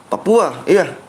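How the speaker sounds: normal talking voice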